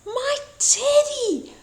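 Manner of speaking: high, slow astonished cry; high surprised gasp of a wolf. A narrator's reading. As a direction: gasp it with sudden joy. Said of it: "gasped Harum Scarum".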